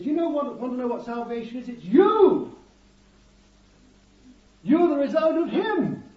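joyful proclamation. Acted. God points at you and declares your salvation, jubilant and loud. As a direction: announce it like the best news ever.

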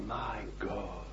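Jesus crying out from the cross; cried out. Retold or imitated. Jesus crying out in the darkness, hushed and aching. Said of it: "in the darkness he cried out".

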